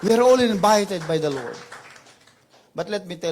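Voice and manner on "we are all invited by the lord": loud praise shout; full-force preaching. Real, not acted. Preacher rousing the congregation to clap, loud shouted hallelujah. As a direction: lift the congregation with a roaring hallelujah.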